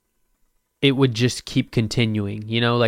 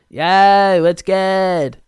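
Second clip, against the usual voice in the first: higher, louder chill bro greeting; 7 semitones higher, 5 dB louder, much slower than usual. Pretend. Podcaster trying the bro greeting again, higher louder bro voice. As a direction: say it like a laid-back bro. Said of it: "I might actually start talking like that".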